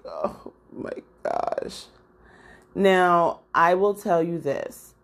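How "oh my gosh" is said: slow, soft, voiceless exclamation; hushed dismay. Retold or imitated. Vlogger seeing her weird unfinished wig, aghast. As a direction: breathe it out in mock horror.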